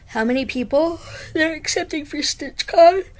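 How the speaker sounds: talking through a yawn; stretched, yawny words. Real, not acted. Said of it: "Sorry about the yawning".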